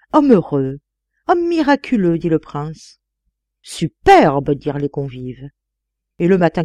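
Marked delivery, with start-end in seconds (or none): 0.1-2.2 mock-grand praise, pitched high at the end; admiring exclamation. A narrator's reading. Prince acclaiming the man who talks of himself, admiring exclamation. 3.6-4.5 loud, emphatic acclaim; admiring exclamation. A narrator's reading. Dinner guests acclaiming the narrator, loud admiration.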